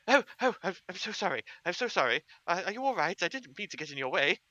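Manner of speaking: high, loud, apologetic little gnome; tiny gnome diplomat bumping into a stranger; flustered gnome. Acted. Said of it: "And they sort of go".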